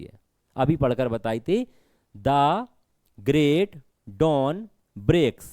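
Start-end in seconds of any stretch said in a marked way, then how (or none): 2.2-5.4 "The great dawn breaks" word by word; spaced-out beats on "The great dawn breaks"; reading for meter. Shown as a demonstration. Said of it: "ऐसे ही पढ़ना है इसको".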